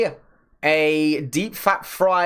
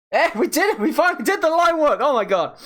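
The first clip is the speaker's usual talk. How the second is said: higher, fast celebrating cheer; thrilled it's finally done; excited; 10 semitones higher, faster than usual. Real, not acted. Finished line work, celebrating.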